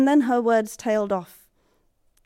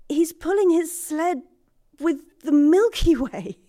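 awestruck wonder; 8 semitones higher, 4 dB louder than usual. Acted. Awestruck describing Strongwind.

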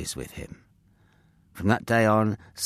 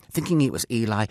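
usual speaking voice

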